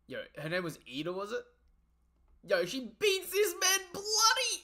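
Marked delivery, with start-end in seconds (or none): 2.5-4.6 loud, high, drawn-out exclamation; excited yell. Real, not acted. Reader reacting to a brutal beating in the story, excited yell. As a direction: shout it out slowly, pitched way up.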